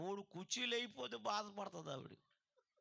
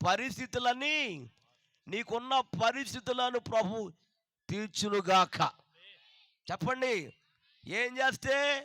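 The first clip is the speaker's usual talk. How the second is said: loud, driving sermon delivery; preacher rousing the congregation to answer; full-force preaching; 6 semitones higher, 15 dB louder than usual. Acted. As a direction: preach it out to the whole hall.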